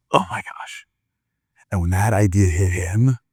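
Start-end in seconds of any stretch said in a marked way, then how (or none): 0.1-0.9 hushed, voiceless amazement; awed whisper. Acted. Man struck by a bold idea, whispering in awe. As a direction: breathe it out as realization lands.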